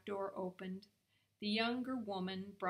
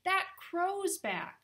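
high, loud, drawn-out announcement; excited woman spotting a familiar visitor; slow, high-pitched exclamation; exclaiming; 11 semitones higher, 8 dB louder, much slower than usual. A narrator's reading. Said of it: "exclaimed the younger woman".

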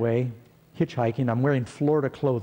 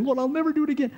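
pleading up high to God, voice raised; desperate plea; 12 semitones higher, much faster than usual. Retold or imitated. Shipmates confessing sins in the storm, high pleading voice. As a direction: beg the Lord for mercy. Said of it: "confessing their sins, saying".

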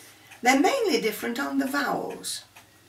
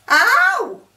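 put-on loud, indignant Cockney flower girl; outraged Cockney street shout; broad Cockney; 3 semitones higher, 8 dB louder than usual. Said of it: "I'll give you an example of, uh, of how we have to, to learn how to speak Cockney".

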